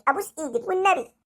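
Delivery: normal talking voice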